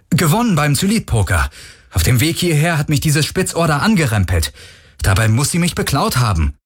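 high, urgent explaining; agitated protest. Acted. Accused man protests to captain.

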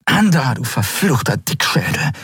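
frustrated outburst; cursing. A narrator's reading. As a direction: curse someone's stubbornness. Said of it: "fluchte Andriel".

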